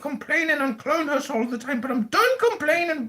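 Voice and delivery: high-pitched mock complainer voice; Saxon complaining. Host mimicking a guest who's here to complain, high-pitched complaining. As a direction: whine in a high put-on voice, building to an indignant finish. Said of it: "Saxon's here to complain".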